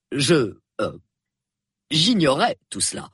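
hesitant, embarrassed admission; falter, then admit it sheepishly; contrite. A narrator's reading. Colonel learns of the young man's bravery, contrite. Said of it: "Il paraissait tout contrit".

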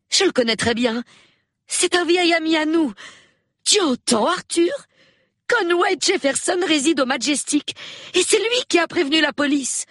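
very high-pitched, excited exclamations; astonished. A narrator's reading. Hostess tells husband of old friend's involvement, astonished. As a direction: exclaim in amazement, voice soaring.

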